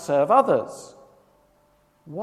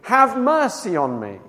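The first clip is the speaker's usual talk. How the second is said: raised, higher, louder plea; drawn-out cry, pitched up; retold shout; 6 semitones higher, 5 dB louder than usual. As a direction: call out slowly for mercy.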